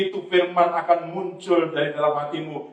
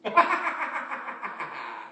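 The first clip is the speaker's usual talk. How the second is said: big dismissive stage laugh; scoffing laugh; 2 semitones higher, 5 dB quieter than usual. Acted. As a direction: laugh tumbling down in pitch. Scoffs at the devil.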